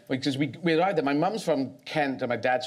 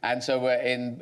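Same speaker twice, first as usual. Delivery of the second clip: ordinary talk, nothing marked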